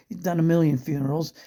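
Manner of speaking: normal talking voice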